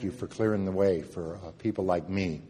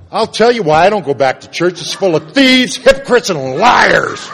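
louder and higher, spitting out the list; angry outburst; 8 semitones higher, 13 dB louder than usual. Retold or imitated. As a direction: rail against the church's hypocrites. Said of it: "And I thought".

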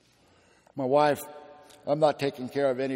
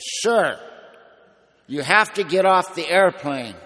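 loud, high, exasperated order; perturbed flight attendant; 6 semitones higher, 12 dB louder, slower than usual. Retold or imitated. Uniformed lady orders a dazed passenger off the airplane, loudly perturbed. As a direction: imitate an attendant at the end of her patience. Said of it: "she's looking at me very perturbed".

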